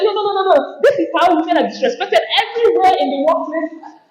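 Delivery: the speaker protesting to a colleague, laughing. Retold or imitated. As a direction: protest while laughing at the scene. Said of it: "I say".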